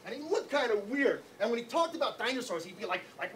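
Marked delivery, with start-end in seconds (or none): none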